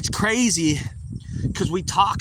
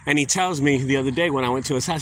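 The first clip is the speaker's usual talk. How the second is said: usual speaking voice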